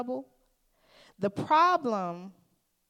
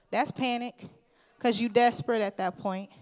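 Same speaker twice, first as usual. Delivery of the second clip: ordinary talk, nothing marked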